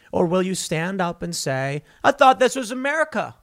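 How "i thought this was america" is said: high, loud indignant protest; defiant outcry. Acted. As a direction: throw it back at them, outraged. Defiant citizen answering mandate.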